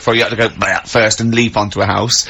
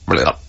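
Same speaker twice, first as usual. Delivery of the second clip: deep, lower croaked blah; low frog croak; 10 semitones lower than usual. Pretend. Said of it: "And you go".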